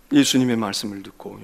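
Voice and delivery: ordinary talk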